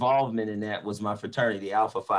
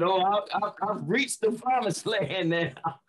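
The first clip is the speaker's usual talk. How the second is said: elated preacher's exclaim; 9 semitones higher than usual. Retold or imitated. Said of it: "I said".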